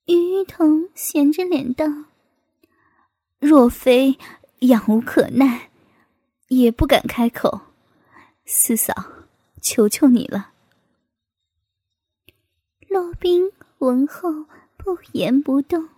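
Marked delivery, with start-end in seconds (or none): 3.4-10.5 low-pitched man's character voice; male character making a request. Acted. Man asks sister-in-law for help, in a low character voice. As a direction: voice him lower than the narrator.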